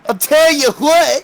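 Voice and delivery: high, slow, hammed-up cowboy drawl. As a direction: camp cowboy, stretched out.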